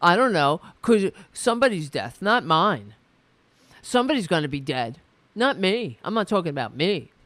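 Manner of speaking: loud, hammed-up denial of a rally goer; mock Trump fan yelling; mock flag holder. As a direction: shout it like a panicky supporter dodging blame. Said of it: "they're like".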